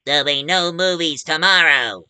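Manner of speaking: mock villain warning from a killer vegetable; menacing carrot villain. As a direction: play the armed carrot announcing doom. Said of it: "carrots carrying guns saying".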